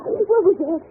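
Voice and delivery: sudden high, loud gasp of fear; startled fright. Acted. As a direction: jump at a noise in the dark. Startled in dark room.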